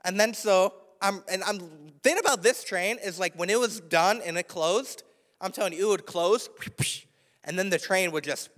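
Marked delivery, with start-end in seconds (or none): none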